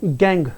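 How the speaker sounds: गैंग with a hard g, not j. Shown as a demonstration. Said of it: "और अगर मैं ऐसे बोलूं".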